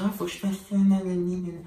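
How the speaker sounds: lightly mocking, high-pitched singsong; mock a fussy, careful person. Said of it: "on va pas dire".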